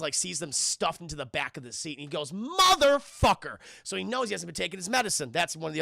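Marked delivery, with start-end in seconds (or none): 2.3-3.6 loud, high, drawn-out mock outrage; outraged curse. Logan discovering Charles skipped his pills, loud outraged curse. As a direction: stretch the curse out loud and slow.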